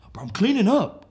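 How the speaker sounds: high, loud excuse; defensive protest. Acted. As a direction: cry out indignantly as the caught houseguest. Houseguest caught, protesting at night.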